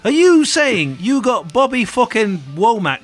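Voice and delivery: outraged Manc rant, playing [name]; Manc accent. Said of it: "living out my favourite Manc accent".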